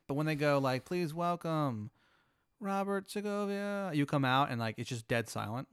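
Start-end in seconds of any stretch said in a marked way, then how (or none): 0.8-3.9 slow, drawn-out, high announcer intro; stage announcer. Acted. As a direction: stretch out the name like an emcee. Emcee introducing the act on stage.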